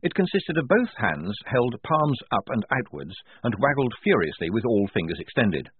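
usual speaking voice

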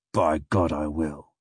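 low, muted vow; grim captain. A narrator's reading. Captain vowing to get his papers, low and grim. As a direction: swear it grimly, voice dropped.

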